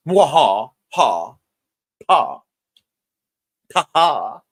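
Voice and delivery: hammy evil villain laugh; mustache twirling villain. Acted. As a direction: cackle like a scheming cartoon villain. Villain laugh about a secret.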